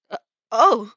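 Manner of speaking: loud, startled, embarrassed yelp; surprised and a bit shy; bashful surprise. Acted. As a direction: caught off guard and flustered. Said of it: "between bashful and surprised".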